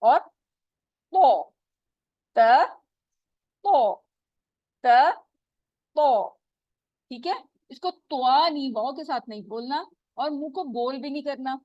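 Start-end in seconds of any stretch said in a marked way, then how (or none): 1.1-6.3 तो heavy and louder, त light; heavy versus light t. Shown as a demonstration. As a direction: alternate a full तो and a flat त. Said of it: "ये हमने ता बोला".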